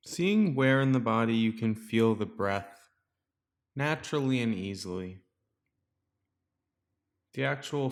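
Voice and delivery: guided meditation. Real, not acted. Teacher guiding listeners to settle into the breath, calm meditation voice. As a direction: guide listeners gently into stillness, leaving space between phrases.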